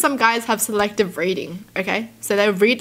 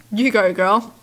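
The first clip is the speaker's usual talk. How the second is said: usual speaking voice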